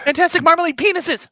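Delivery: sudden high, slow blurted shout; mock tic outburst.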